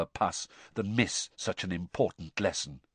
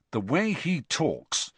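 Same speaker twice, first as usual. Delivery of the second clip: ordinary delivery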